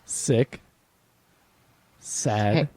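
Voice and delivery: low, one word at a time; halting sign-off. Real, not acted. Host naming the podcast, oddly halting. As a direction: pause heavily between the title words. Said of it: "Why did you say it like that?".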